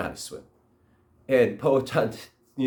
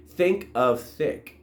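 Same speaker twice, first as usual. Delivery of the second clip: slow instruction; 2 semitones higher, much slower than usual. Acted. Guide gives a calming instruction.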